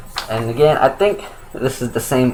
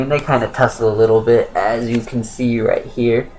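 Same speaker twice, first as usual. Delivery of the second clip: usual speaking voice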